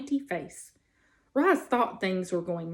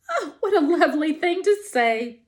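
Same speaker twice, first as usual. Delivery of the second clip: amused laugh; 6 semitones higher, 4 dB louder than usual. Acted. Possum amused by robot.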